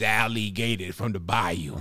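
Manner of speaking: Cajun bayou puppet voice; Louisiana accent. Acted. Presenting his gator puppet to co-hosts, Louisiana bayou accent. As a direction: introduce him in a Louisiana drawl. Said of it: "Give me a Louisiana accent".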